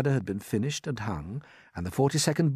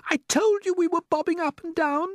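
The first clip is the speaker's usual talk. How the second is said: very high and loud, told-you-so; ladybird voice; 6 dB louder than usual. A narrator's reading. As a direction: point it out sharply. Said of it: "the ladybird said".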